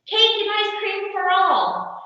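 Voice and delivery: high, loud kingly announcement; king decreeing a treat for everyone; royal proclamation. A narrator's reading. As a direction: proclaim it to the whole kingdom. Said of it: "and the king proclaimed".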